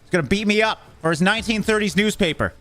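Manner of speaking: high frightened rush; fleeing in a panic; scared horror game streamer. Real, not acted. Being chased.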